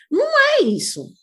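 high, loud, slow "Não é isso"; loud, drawn-out correction; emphatic denial. Real, not acted. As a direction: reject it firmly with "é" stretched high. Emphatic rejection of the parody.

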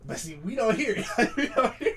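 high, loud laughing protest; defending their parents through laughter. Real, not acted. Laughing while defending parents' accents.

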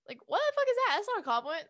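loud, high-pitched indignant outburst; outraged shout. Real, not acted. A woman ranting at backhanded compliments, shouting in outrage.

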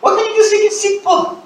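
high-pitched, exasperated plea. Acted. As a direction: plead in high-pitched mock frustration. Exasperated at church jargon.

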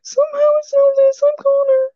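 absurdly high cartoonish falsetto; Connor joke voice. Shown as a demonstration. Showing what the joking voice evolved into, silly falsetto. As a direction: squeal it way above your own pitch. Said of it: "it's evolved from that".

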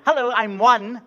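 the one dollar bill's eager hello; chirpy one dollar. Acted. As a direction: introduce yourself brightly. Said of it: "the one dollar said".

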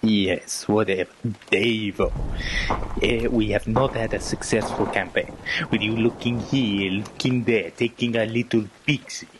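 grave German officer voice; brief the men in German accent. Acted. German officer sketch dialogue.

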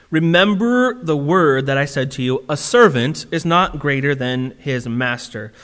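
solemn Bible reading; scripture reading. Acted. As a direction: read the words of Jesus with weight. Reads scripture aloud.

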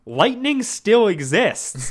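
slow, emphatic mock-dad retort; stretched-out retelling of dad's correction; imitated dad. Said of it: "my dad being like".

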